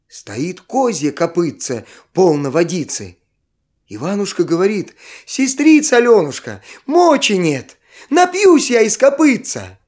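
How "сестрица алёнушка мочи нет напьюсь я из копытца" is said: put-on higher, desperate child's plea; little boy voice. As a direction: beg like an exhausted little boy. Little brother begs for water.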